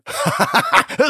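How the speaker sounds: high-pitched manic cackle; clownish ghostly laugh. A narrator's reading. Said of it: "И снова клоунский смех призрачного силуэта".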